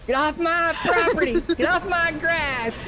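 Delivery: put-on high, slow grumpy old man yell; shouting at trespasser. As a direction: shoo kids off your porch. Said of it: "old men sitting on my front porch".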